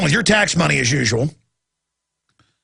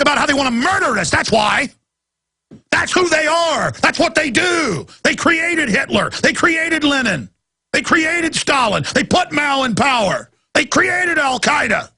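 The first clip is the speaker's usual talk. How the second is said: ranting; 6 semitones higher than usual. Real, not acted. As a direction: hammer each line out as an accusation. Ranting about elites.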